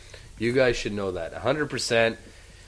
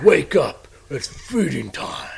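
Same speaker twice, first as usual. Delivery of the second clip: catchphrase imitation; 4 semitones higher, 4 dB louder, much slower than usual.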